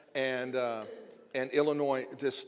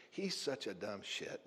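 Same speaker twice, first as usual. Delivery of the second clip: muttered aside; 6 semitones lower, 12 dB quieter than usual. Retold or imitated. Secretary's blunt aside about pastor.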